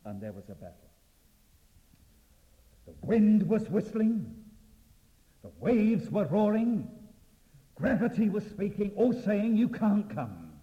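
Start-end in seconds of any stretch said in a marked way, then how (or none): 2.9-10.6 loud, high dramatic preaching; roaring storm. Acted. Preacher builds the battle of storm against Peter, loud and dramatic. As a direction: hurl each phrase out over the storm.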